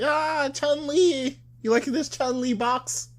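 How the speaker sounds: high, drawn-out squealed parody; mock her in a slow, very high voice; exaggerated high-pitched impersonation. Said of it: "she's like".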